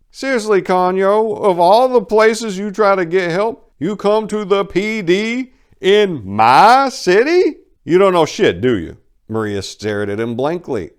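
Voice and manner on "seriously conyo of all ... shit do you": high, loud, slowing to sneer; mocking crime boss. Duke Willie mocking Maria's choices, high and loud. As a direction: sneer at how dumb she was.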